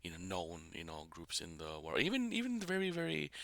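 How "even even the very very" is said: pitched up. Real, not acted. Telling fans he knew even unknown groups, high excited. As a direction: voice jumps much higher on very.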